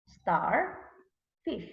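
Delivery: "star" and "fish" as separate beats; read by syllables. Shown as a demonstration. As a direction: pause between "star" and "fish". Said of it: "we are going to read by syllables".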